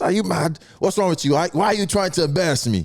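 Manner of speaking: mock scolding in an African accent; African dad accent. African dad to son embarrassing him, indignant African accent. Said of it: "you know Africans be like".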